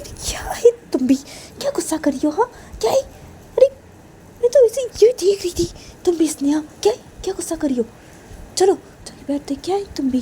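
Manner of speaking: high-pitched, exasperated whine; put-upon girl soothing a quarrel; protesting friend. A narrator's reading. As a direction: plead innocence, fed up with the fuss. Voices protesting Neha.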